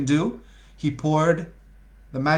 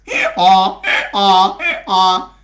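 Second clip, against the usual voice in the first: loud nasal hee-haw imitation; donkey bray; 3 semitones higher, 7 dB louder, slower than usual. Teller imitating Bottom's donkey bray.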